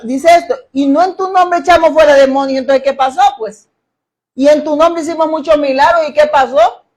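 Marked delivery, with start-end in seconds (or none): 0.7-2.6 pleading false prophet. Acted. Quoting those who claim miracles. 4.3-6.1 indignant claimant. Acted. Quoting those who claim miracles.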